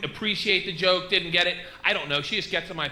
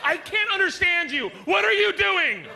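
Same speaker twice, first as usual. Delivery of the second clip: yelling wife; 11 semitones higher, 4 dB louder than usual. Acted. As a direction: shout at him like an annoyed wife.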